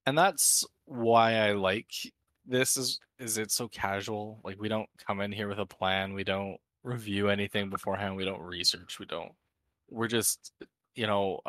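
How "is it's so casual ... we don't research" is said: usual speaking voice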